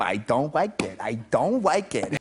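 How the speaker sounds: "like" said as "wike", with a W for the L; small child's W for L in "like"; toddler speech impediment. Retold or imitated. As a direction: say "like" with rounded lips, as "wike". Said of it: "L's and the R's turn into".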